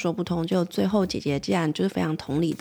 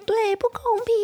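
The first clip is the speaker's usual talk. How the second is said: put-on much higher, piping child voice; toddler voice. Little sister chimes in agreeing with mom, piping toddler voice. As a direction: chirp it like a three-year-old.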